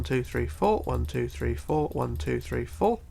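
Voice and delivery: ordinary delivery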